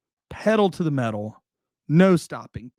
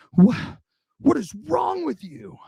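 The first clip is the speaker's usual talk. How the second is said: high, winded demand, much higher; breathless guard; 10 semitones higher than usual. Retold or imitated. Guard who chased the car confronts the driver, high breathless demand. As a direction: gasp out an indignant question after running. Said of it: "breathing super heavy".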